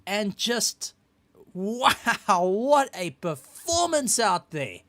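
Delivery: high, excited, slow announcer voice; big hype host introducing the champ. Acted. Host hypes the race winner.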